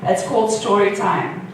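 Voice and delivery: ordinary delivery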